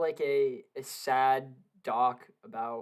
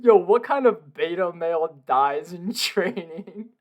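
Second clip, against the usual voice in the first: laughing tease; 3 semitones higher, 8 dB louder, slower than usual. Real, not acted. Teasing friend while laughing.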